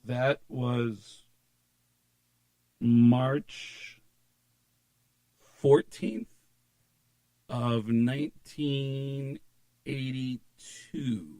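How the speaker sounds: very slow, long gaps between words; slow recall. Retold or imitated. Musician retrieving a date for a fan, slow recall. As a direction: draw each piece of the date out.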